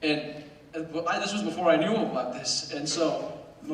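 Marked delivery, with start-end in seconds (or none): none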